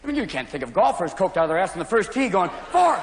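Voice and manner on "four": much higher, frantic shout; frantic golfer yell. Retold or imitated. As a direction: yell at the ball like a wired golfer. Coked golfer yelling on tee.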